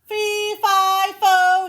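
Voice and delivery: high, loud booming giant chant; bellowing giant. Acted. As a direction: boom each syllable like a giant.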